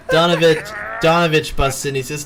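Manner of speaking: ordinary talk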